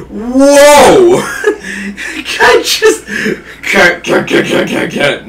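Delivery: high shrieking laughter; laughing scream. Real, not acted.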